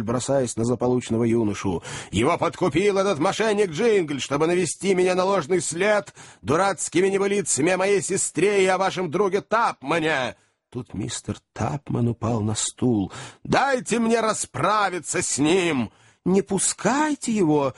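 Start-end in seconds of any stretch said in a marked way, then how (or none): 2.1-10.3 high, indignant tirade; angry shouting. A narrator's reading. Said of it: "закричал мистер Уордль". 13.4-15.9 slow, high enraged demand; furious yell. A narrator's reading. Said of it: "закричал мистер Уордль". 16.2-17.7 high, alarmed squeal; women's shriek. A narrator's reading. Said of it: "завизжали все женщины".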